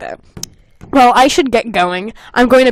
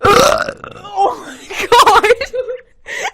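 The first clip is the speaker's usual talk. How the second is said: laughing; 12 semitones higher, 3 dB louder, much slower than usual. Real, not acted. Laughing after burp.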